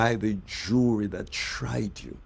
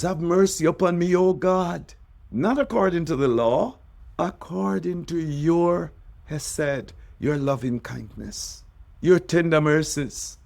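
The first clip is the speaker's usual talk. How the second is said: impassioned psalm plea; 8 semitones higher than usual. Acted. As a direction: cry out the psalm to God, full of longing. Preacher cries out David's psalm.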